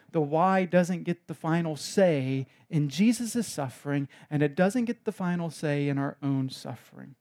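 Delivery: usual speaking voice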